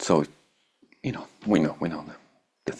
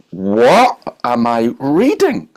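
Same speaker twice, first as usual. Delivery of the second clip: slow, loud, higher cry of disbelief; stunned disbelief; 7 semitones higher, 11 dB louder, much slower than usual. Retold or imitated. Reader shocked by the gospel text, slow loud disbelief. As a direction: stretch each word, amazed by the page. Reacting to scripture.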